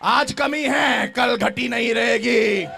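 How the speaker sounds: fiery sermon shout; full-force preaching. Acted. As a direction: preach it out to the crowd.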